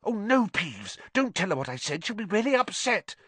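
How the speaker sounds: high, urgent hushed plea; frantic whispering. Acted. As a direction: beg frantically, keeping it down. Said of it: "Hermione whispered frantically".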